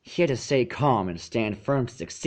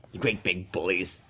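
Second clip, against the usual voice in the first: sulky, lower and softer grumble; 7 semitones lower, 7 dB quieter than usual. A narrator's reading. Spike sulking at the ponies who woke him, lower and softer sulky. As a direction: lower and softer, a dragon sulking under his breath. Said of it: "he said sulkily".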